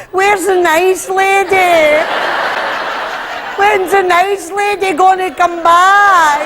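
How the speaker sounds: hammed-up terrified plea, drawn out slowly; scared pleading. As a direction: beg like a frightened child.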